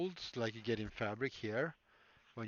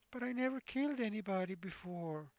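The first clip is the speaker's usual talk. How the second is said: much higher frightened patient voice; pleading patient; 9 semitones higher than usual. Acted. Patient protests to the surgeon, much higher pleading voice. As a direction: plead like a scared victim.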